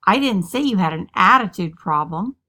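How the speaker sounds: "attitude" punched loud; strong emphasis on "attitude", as if some other problem were meant; stress on ATTITUDE. Shown as a demonstration. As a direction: hit the word "attitude" hard. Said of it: "just by emphasizing a different word".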